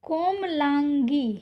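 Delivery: कोमलांगी said slowly, syllable by syllable; slow dictation. Shown as a demonstration. Teacher slowly reads कोमलांगी to students. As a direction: draw out कोमलांगी for the class. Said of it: "अशुद्ध में दिया गया है".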